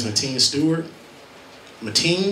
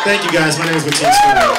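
loud announcement; 2 semitones higher, 9 dB louder than usual. Real, not acted. Loud second self-introduction.

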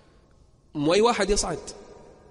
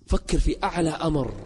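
usual speaking voice

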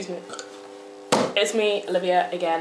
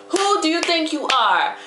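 put-on tough-talking girl outraged over her chicken; sassy, indignant girl; 9 semitones higher than usual. Confronting the girl who stole her chicken, indignant.

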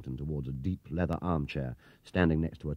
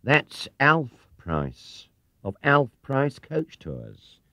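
very slow, each word laid out; slow spelling it out; 7 semitones higher, 5 dB louder, much slower than usual. Acted. Clerk correcting Paddington, slow and patronising. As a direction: explain it like to a child.

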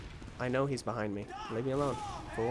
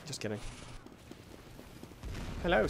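ordinary delivery

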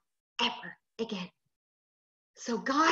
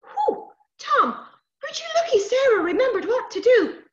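high, loud little creature voice; hedge sprite; 13 semitones higher, 7 dB louder than usual. Acted. Hedge sprite to rescued boy, high relieved scolding.

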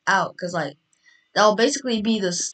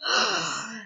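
surprised gasp; 8 semitones higher, 3 dB quieter than usual. Acted.

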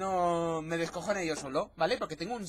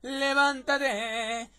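very slow, stretched wake-up call; drawn-out wake-up call; 4 semitones higher, much slower than usual. Acted. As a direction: drag out every syllable to wake them. Same wake-up line, stretched out. (llamada alargada para despertar)

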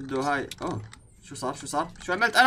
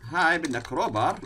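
ordinary talk, nothing marked

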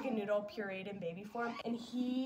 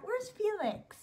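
put-on much higher, louder baby-talk call; child-directed question; 10 semitones higher, 4 dB louder, slower than usual. Asking baby where the cat is.

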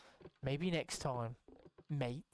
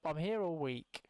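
ordinary talk

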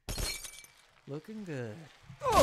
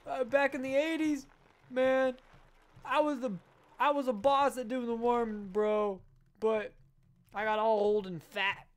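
put-on old-timer voice; 5 semitones higher, 6 dB louder than usual. Character monologue about the eighties.

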